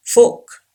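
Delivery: fork said with no R sound, fok; R silent. Shown as a demonstration. Teacher modelling fork without the R. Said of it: "Yes, R is silent".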